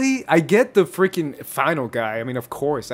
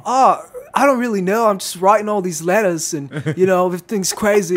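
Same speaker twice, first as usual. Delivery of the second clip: higher-pitched mock Australian accent; exaggerated Australian accent; 4 semitones higher, 4 dB louder than usual. Host mimicking video parody of Hannah, Australian accent. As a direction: imitate Hannah with broad Australian vowels. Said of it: "re-say all her lines in, in, with an Australian".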